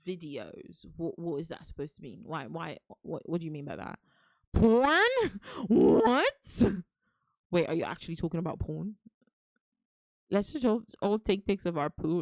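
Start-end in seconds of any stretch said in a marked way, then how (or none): 4.5-6.6 loud, high outraged disbelief; shocked disbelief. Real, not acted. Reacting to chat's porn comment, loud shocked yelp. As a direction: yelp it out, caught off guard.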